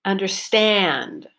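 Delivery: slow "understand", long stressed last syllable; third-syllable stress. Shown as a demonstration. Teacher breaks down the focus word, stress on the end of "understand". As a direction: stretch the "stand" in "understand" and let it fall. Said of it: "third syllable that I stressed".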